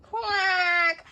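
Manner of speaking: a single little toy-duck quack. Acted. Pet duck calls out.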